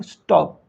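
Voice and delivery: "Stop" as one stressed, falling syllable; teacher sounding out "stop" with weight on the vowel; stressed syllable demonstration. Shown as a demonstration. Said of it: "इस पे stress पड़ रहा है". ("Stop" पर ज़ोर, गिरते सुर में)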